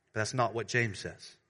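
usual speaking voice

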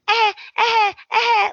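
cutesy posing grunts; mock posing noises. Imitating Brooke posing sounds.

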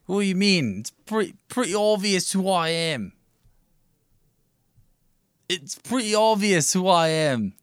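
high, loud Cockney judge voice; hammed-up Cowell with a Cockney twang; Simon Cowell impression. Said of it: "It's me, Simon Cowell".

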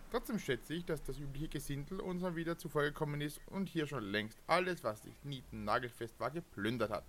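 measured adventure narration; epic fantasy narrator. Acted. Reads the game text aloud. (epischer Fantasy-Erzähler)

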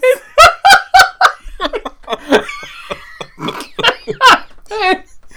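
helpless high laugh; high burst of laughter. Real, not acted. Cracks up at Ys name.

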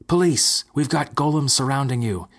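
loud, high alarmed shout. Acted. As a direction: yell it out to warn everyone. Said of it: "Ethel shouted".

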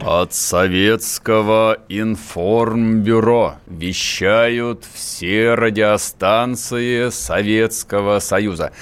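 slow, grand Soviet radio announcer; booming solemn state announcer. Pretend. Parody of Soviet wartime radio announcement.

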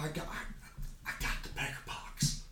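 hushed, halting whisper; confessional whisper. Acted. Customer confesses his ailment to the madam, whispering. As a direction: whisper it as a shameful secret.

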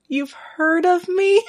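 high, slow, delighted surprise; flattered Steed. Retold or imitated. Podcaster voicing Steed thrilled Blackbeard knows him, high slow. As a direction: squeak it out, flattered to be known. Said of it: "Steed's all like".

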